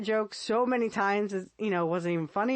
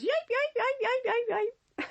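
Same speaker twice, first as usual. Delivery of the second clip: much higher cartoon yelps trailing off; yelping bear. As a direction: squeal like a bear smacked on the behind. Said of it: "the bear goes".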